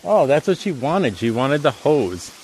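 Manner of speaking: ordinary talk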